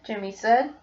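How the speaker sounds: ordinary delivery, nothing marked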